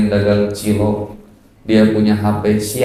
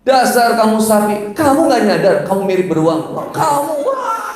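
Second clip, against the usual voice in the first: high-pitched mock squabble; hammed-up shrieky bickering; quarrelling couple; 10 semitones higher than usual. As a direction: escalate into a squealed comic row. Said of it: "Tapi kalau ibu lawan".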